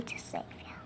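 breathy whispered promise. Acted. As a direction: whisper it secretly. Unvoiced whisper heard.

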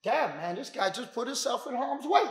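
low, soft self-talk; inner voice. Acted. Defender sizes up the man to himself, low soft self-talk. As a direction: think it aloud under the noise. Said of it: "you need to say to yourself".

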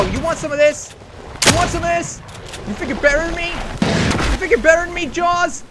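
loud, high trash talk; hammed-up tough guy taunting the shark; mock taunting. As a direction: goad the shark into a fight.

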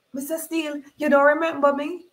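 high, imploring question; high-pitched, disbelieving appeal; pleading. Acted. Mother begs to be remembered.

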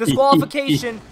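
high, loud call for a ruling; excited shout. Real, not acted. Player demanding a disqualification, excited shout. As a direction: shout it out to the ref.

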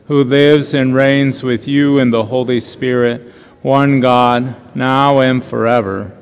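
measured, reverent liturgical prayer voice; solemn prayer. Acted. Pastor leading the congregation in the opening prayer, solemn and measured. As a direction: pray it slowly and solemnly to the congregation.

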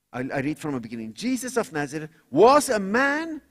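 loud, high rallying preacher cry; preaching at full force. Acted. Preacher to congregation about being saved, loud rousing preaching. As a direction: rouse the congregation to call on the Lord.

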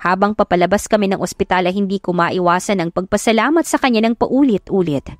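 usual speaking voice

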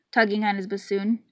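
plain reading voice

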